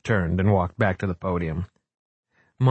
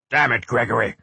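mild thundering; 3 semitones higher than usual. A narrator's reading. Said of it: "Frock thundered".

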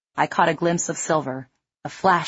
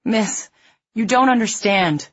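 moaned; 6 semitones higher, 3 dB louder than usual. A narrator's reading. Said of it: "the fat man moaned".

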